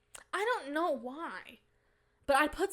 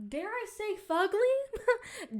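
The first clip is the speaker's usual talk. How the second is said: higher, coy, teasing; mock scandalized; 10 semitones higher, 4 dB louder, much slower than usual. Teasing viewers with a naughty word, higher and coy.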